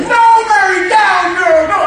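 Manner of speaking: high, half-laughing shout to settle her down; Jesus fending off an overjoyed Mary, laughing shout; laughing, loud rebuke. Acted. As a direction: call her off like an eager pup.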